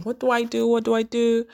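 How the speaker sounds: high, level repeated self-questioning; contemplating aloud. Retold or imitated. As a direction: re-enact fretting over a decision. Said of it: "I was contemplating".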